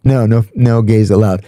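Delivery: ordinary talk, nothing marked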